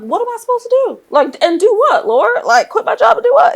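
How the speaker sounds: high-pitched, loud protest with laughter creeping in; retold yelling. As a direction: re-enact yelling at the Lord in exasperation, voice way up. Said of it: "I just remember yelling".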